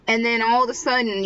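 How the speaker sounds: ordinary delivery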